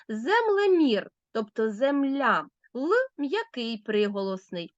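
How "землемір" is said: Землемір drawn out slowly, slightly higher; slow example word. Shown as a demonstration. Teacher to children, slowly showing Землемір. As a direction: lay out Землемір slowly so pupils hear the parts. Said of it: "після м'якого приголосного".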